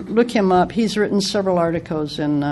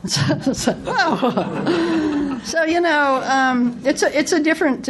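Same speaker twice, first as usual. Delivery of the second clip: talking through a high laugh; 9 semitones higher, slower than usual. Real, not acted. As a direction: still laughing as the words come out.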